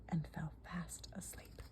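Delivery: hushed, nearly whispered bedtime narration. A narrator's reading. Narrator lulls as Goldilocks falls asleep, hushed. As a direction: let it sink softly into a whisper.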